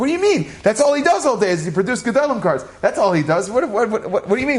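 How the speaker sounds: put-on a nine-year-old insisting, baffled; childish protest.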